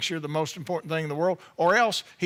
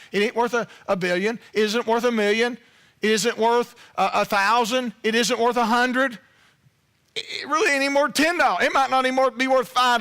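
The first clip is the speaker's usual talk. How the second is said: loud, raised preacher's drive; preaching at full force; 5 semitones higher, 4 dB louder than usual. Acted. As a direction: hammer it home to the congregation.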